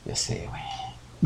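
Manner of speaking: slow, breathless whisper; slow whispered aside. Real, not acted. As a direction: hush it out, caught red-handed. Mock-embarrassed aside after spoiler.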